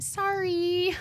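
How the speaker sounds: high, drawn-out sorry; retold sheepish apology. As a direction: squeak out a mock apology.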